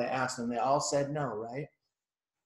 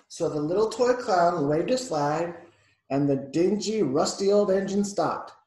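louder, animated picture-book narration; story narration for children; 3 semitones higher, 7 dB louder than usual. A narrator's reading. Reading a picture book to a child, animated narration. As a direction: read it out with emphasis for a child.

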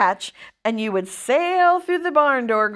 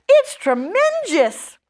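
high, loud, drawn-out amazement; amazed exclamation; 9 semitones higher, 5 dB louder, slower than usual. A narrator's reading. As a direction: stretch it out in awe, high and loud. Boy amazed by the spider.

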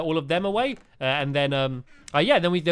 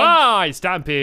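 slow, louder, drawn-out hello in a character voice; character greeting; 7 dB louder, much slower than usual. Pretend. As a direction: stretch out the greeting like a goofy character. Greeting game character Kevin.

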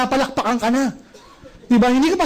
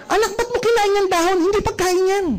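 higher-pitched exasperated mum voice; annoyed parent scolding; 9 semitones higher than usual. Retold or imitated. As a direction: scold the noisy kid, fed up.

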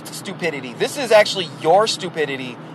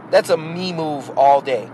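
normal talking voice

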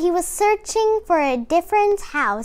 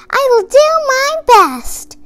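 high, loud eager mouse; a tiny mouse promising to behave; 11 semitones higher, 6 dB louder, faster than usual. A narrator's reading. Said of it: "and Mouse said".

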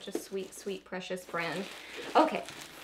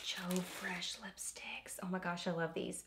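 whisper; 2 semitones lower, 11 dB quieter than usual. Real, not acted. Reading gift label aside.

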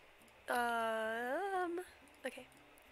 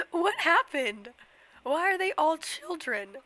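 loud, high, disbelieving shout at the chickens; shocked outcry; 4 semitones higher, 10 dB louder, slower than usual. Real, not acted. Baby chickens shock her.

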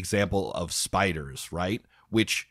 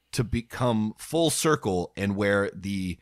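usual speaking voice

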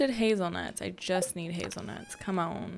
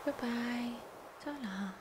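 whispering; 7 dB quieter than usual. Real, not acted. Soft whisper to boat.

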